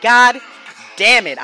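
loud, high exasperated outburst; exasperated curse. Retold or imitated. Man finding cops at his door, exasperated. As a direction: curse loudly in dismay, pausing mid-phrase. Retold shock at seeing cops.

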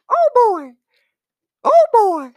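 very high, loud squeaky cartoon mouse; Mickey Mouse impression. Imitating Mickey for a friend, high and loud. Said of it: "what is Mickey like?".

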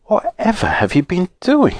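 high, astonished question; astonished mother. A narrator's reading. Mother seeing the children's bruises, astonished. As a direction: exclaim in high-pitched dismay. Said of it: "Mother was astonished".

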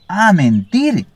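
a bird screeching the word back in fury; furious screech. A narrator's reading. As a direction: spit it back in disbelief and rage. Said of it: "chilló furioso".

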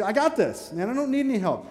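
high-pitched cocky dismissal; proud self-reliance. Retold or imitated. Preacher voicing someone too proud for help, high and cocky. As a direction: brush off any help like you've got it handled. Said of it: "I was unwilling to receive".

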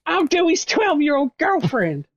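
put-on high, slow girlish voice. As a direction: pipe it like a young girl. Voicing a character.

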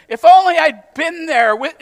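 loud, high, drawn-out longing; emphatic wishing. Acted. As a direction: belt out the wish, slow and high. Acting out the wishful claim, emphatic.

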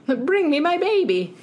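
high, pleading mother voice; a frantic penguin mom. Retold or imitated.

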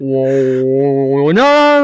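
vocalised instrument drone; imitated didgeridoo. Shown as a demonstration. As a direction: hold a didgeridoo note and let it climb.

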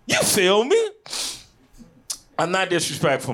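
normal talking voice

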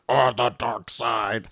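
slow, put-on attempt at a villain; copied villain voice. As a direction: imitate a kid copying the evil voice. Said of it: "Juliana tries to copy".

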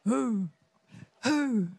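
hooting "who" like an owl; wise owl. Retold or imitated. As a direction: draw out each who as a hoot. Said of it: "Be like the wise owl".

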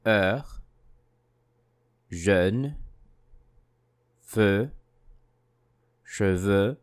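each word rising as in a list; listing tune. Shown as a demonstration. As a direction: read the words as a list, each going up. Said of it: "let's go over the words one more time".

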